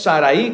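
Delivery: "Sarai" as sa-ra-Í, last vowel stressed; wrong-way demo, "Sarai" ending in a stressed í; stress on final I. Shown as a demonstration. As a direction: say "Sarai" with the i split off and accented. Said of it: "con acento en la I".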